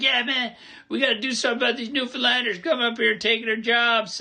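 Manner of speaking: mock a fired-up radio caller; angry caller. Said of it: "he's like".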